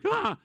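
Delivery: short high yelp of panic; quick startled airplane scream; mock scream. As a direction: imitate a passenger's scream. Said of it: "they scream like".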